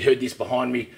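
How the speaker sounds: normal talking voice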